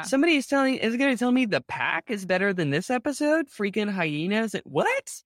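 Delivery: high, incredulous rant about the ranking; mock outrage. Real, not acted. Podcaster ranting to her co-host about the pack episode, mock outraged. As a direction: act scandalized, pitch up high.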